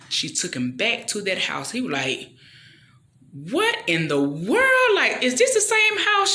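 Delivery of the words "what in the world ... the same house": slow, then high and loud astonishment; amazed husband. Retold or imitated. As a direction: gasp at the transformed house. Husband amazed at renovated house.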